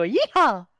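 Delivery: high, loud yeehaw whoop; cowboy yell. Retold or imitated. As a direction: whoop it like a cowgirl. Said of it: "she go".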